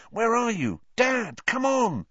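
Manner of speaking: high, urgent plea to Dad; high-pitched, desperate calling under pressure; anxious plea. A narrator's reading. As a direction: plead for someone to show up. Harry anxiously calls for his father.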